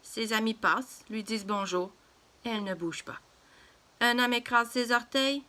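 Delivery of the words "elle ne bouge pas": ordinary delivery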